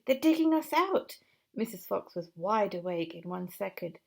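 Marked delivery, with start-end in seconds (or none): 0.0-1.2 high urgent shout to wake the family. Acted. Mister Fox waking his family as diggers close in, high shout. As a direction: yell them awake, high and loud. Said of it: "he shouted".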